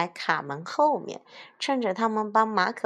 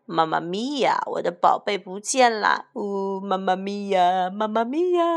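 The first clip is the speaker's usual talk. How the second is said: hammed-up wailing. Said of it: "马可波罗坐在木箱上大哭".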